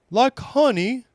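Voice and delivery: high, hopeful bear voice; eager Pooh. Acted. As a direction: blurt it out hopefully. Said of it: "Pooh couldn't help saying".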